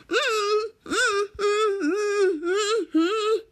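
high whimpering mm sounds; tearful mumbling hums. Retold or imitated. Mika tries to explain through muffled crying hums. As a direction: hum through mock tears. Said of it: "Mika mumbles".

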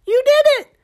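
high, loud gecko shout; excited scream. Acted. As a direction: scream it with joy. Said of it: "Gecko screamed".

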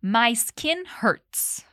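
My skin hurts said very slowly for learners; slow model line. Shown as a demonstration. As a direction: spell it out slowly, word by word. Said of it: "Mais uma vez".

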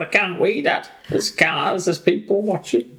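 high-pitched, put-on dog complaining to Dad; voiced reluctant dog. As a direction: play a self-conscious dog pleading in a high voice. Voicing her reluctant dog by the road.

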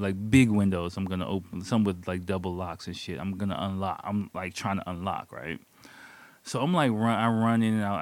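normal talking voice

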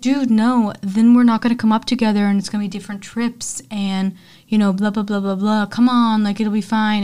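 loud, pushy whining protest; throwing a fit. Retold or imitated. Mark pressuring friends to take mushrooms, loud whining fit. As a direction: whine and push hard, loudly. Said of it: "throws a fit".

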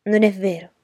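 indignant, forceful contradiction; roared denial. A narrator's reading. Child narrator answering the cousin's taunt, mild roar.

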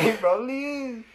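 high, laughing show-host echo. Retold or imitated. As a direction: copy the host voice for fun. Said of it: "like a game host".

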